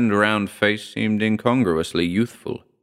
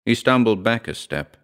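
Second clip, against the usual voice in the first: usual speaking voice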